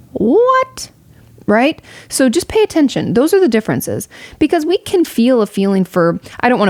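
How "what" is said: sudden, much higher startled yelp; startled what. Real, not acted. Interrupting her own explanation, startled and much higher. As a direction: caught off guard by something.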